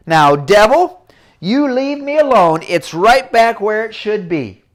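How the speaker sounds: loud, slow command to the devil; stern rebuke. Acted. Girl ordering the devil to leave her, loud and slow. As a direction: tell the devil off firmly.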